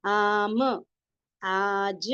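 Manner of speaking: long held aa opening आम and आज; stretched alif madd. Shown as a demonstration. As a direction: draw out आम, then आज, each with a separated final consonant. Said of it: "अलिफ की हम आवाज को खींच रहे हैं".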